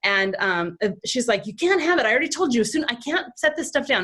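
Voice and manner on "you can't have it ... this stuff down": higher, strained mom at the end of her rope; exasperated mom. Retold or imitated. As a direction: snap back like a frazzled mom. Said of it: "she's totally hot and exasperated".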